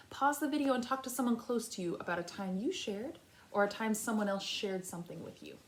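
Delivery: normal talking voice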